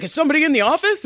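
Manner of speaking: startled patient. Retold or imitated. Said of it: "he's like".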